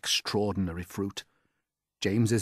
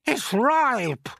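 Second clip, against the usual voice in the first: a far higher, louder aunt's shout; Aunt Spiker shouting; 8 dB louder than usual. Acted. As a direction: screech it out as the nasty aunt. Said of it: "she cried".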